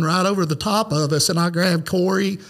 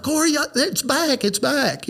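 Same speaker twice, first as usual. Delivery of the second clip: high urgent call to his son; alarmed, calling Corey over; 8 semitones higher than usual. Retold or imitated. Calling Corey to see the light, high urgent alarm. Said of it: "I was like".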